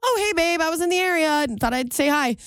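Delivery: high, breezy imitation of a boyfriend dropping by; mock cheery boyfriend. Mimicking boyfriend's cheery drop-in.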